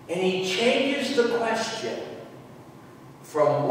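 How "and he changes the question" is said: usual speaking voice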